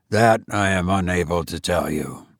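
a hint of a tired old man. A narrator's reading. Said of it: "he said slowly".

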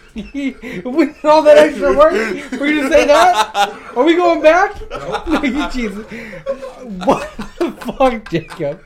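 high, loud laughing protest, slowed; laughing outrage. Real, not acted. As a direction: laugh through the complaint. Laughing outrage at friends returning.

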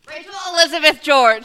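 loud scolding full name. Real, not acted. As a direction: call her out by full name.

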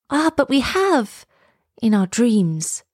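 lightly voiced children, drawn out slowly; a hint of dreamy children, slow. A narrator's reading. Children answering the mathematical master, lightly voiced and slow. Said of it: "answered the children".

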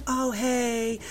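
soft, understated casual greeting; acted happy greeting. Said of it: "all happy".

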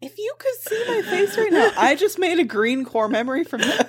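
high laughing voice. Real, not acted. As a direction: laugh through it.

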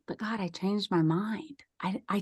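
breathy whisper, pleading with God; whispered prayer. Retold or imitated. Whispering to God on the river, pleading in a hushed voice. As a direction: take it back in a whisper.

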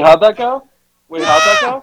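excited. Real, not acted. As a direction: beg to hear it again. Asking for replay excitedly.

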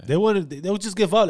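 ordinary talk

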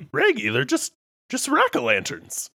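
cartoon talking dog, starting high; Scooby-Doo. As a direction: talk as a dog, R in front of words. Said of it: "Scooby is, like, scared".

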